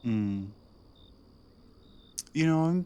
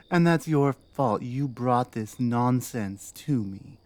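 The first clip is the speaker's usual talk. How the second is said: normal talking voice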